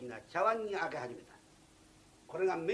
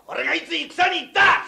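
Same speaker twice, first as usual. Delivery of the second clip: very loud, much higher; drunk shout; 11 semitones higher, 16 dB louder than usual. Acted. Drunk guest roars at the jester. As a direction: roar it back at him.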